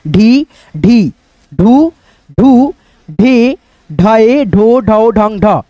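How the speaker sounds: drawn-out, sing-song recital of each syllable; syllable drill. Shown as a demonstration. Teacher reciting the ज syllable chart to students, sing-song. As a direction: chant the barakhadi one by one for pupils. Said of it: "मैं समझा रहा हूँ".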